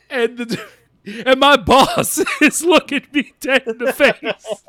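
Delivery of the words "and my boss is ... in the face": high, laughing through it; laughing retelling. Real, not acted. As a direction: crack up mid-sentence. Laughing mid-story.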